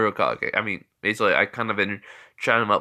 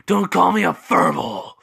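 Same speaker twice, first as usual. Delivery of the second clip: slow, higher growling beast voice; angry beast Kurama; 6 semitones higher than usual. Acted. Kurama objects to being called a fur ball, angry growl. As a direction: growl it out like an offended monster. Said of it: "Kurama says".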